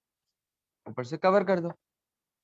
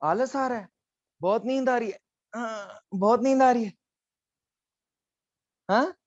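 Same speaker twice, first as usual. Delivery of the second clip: 1 mock sleepy whine; 5 semitones higher than usual. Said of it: "teacher mocks sleepy students".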